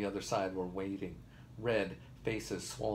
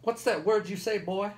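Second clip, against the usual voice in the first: loud, very high shouted question; high-pitched heckling holler; heckler's yell; 11 semitones higher, 8 dB louder than usual. A narrator's reading. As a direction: yell it across the room at the speaker. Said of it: "and they yelled".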